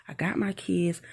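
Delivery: usual speaking voice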